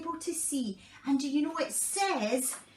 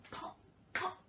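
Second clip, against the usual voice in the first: whispered pops; 9 dB quieter than usual. Acted. Popcorn popping sound.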